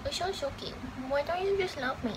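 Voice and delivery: doting coo to a pet who won't be held. Real, not acted. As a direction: tease and cuddle a wriggling pet. Cuddling a squirming pet.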